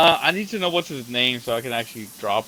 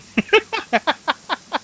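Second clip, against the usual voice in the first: high squealing laughter; high cackling laugh; 6 dB louder than usual. Real, not acted. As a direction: break into a shrieking laugh. Cracking up after the joke.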